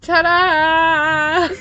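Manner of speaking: loud, high showman ta-da; excited ta-da. Pretend. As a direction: announce it like a magician. Showing bug for child.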